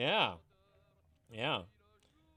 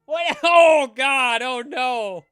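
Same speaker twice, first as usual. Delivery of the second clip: very loud high cries of shock; shocked laughter; 8 semitones higher, 14 dB louder, much slower than usual. Real, not acted. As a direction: laugh and groan in horror.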